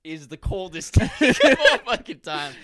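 laughing through the words. Real, not acted. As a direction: crack up mid-sentence. Laughing at Biden quote.

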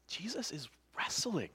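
whispered, soft revelation; hushed emphasis. Real, not acted. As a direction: drop to an intent whisper. Hushed emphatic point after the acting.

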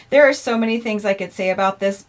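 ordinary talk

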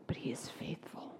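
fading to a whisper; hushed, intimate reassurance. Acted. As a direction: whisper the promise of faithfulness. Hushed sermon point.

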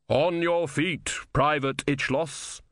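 measured otherworldly order; solemn summoner. A narrator's reading. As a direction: intone it slowly, like Death calling someone up. Death summons the dead soldier.

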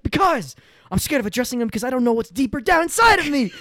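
loud high anguished bully voice; mock confession. Retelling a sketch bully confessing fears, loud high. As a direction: blurt it out like an overwrought bully.

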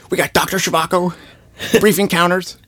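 mock the angry Unforgivable guy. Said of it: "I'm butchering that impression".

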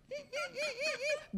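tiny, high-pitched effort hums; mimicked scooting squeaks. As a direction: squeak softly with each little scoot. Imitates patient scooting further.

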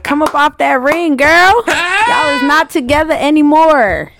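high, hammed-up demand; sassy mock demand. Telling an ex to return the ring, mock indignation. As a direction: call her out with playful outrage, pitch climbing. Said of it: "I'm gonna be like".

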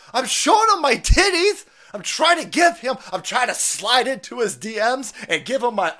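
high, loud, whiny shrieking coworker; shrill woman voice. Pretend. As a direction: shriek it like a desperate flirt. Acting out a flirting coworker.

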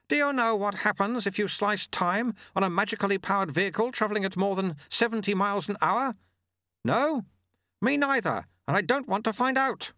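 high-pitched, carrying character voice; sweeper calling over wind. Acted. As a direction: call it out high to a fellow rider.

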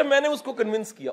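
ordinary talk

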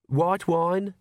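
ordinary delivery, nothing marked

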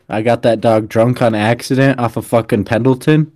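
usual speaking voice

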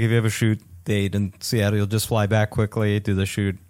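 normal talking voice

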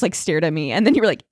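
ordinary talk, nothing marked

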